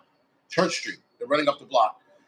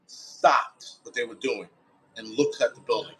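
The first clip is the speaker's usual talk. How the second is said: normal talking voice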